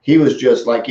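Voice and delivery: ordinary delivery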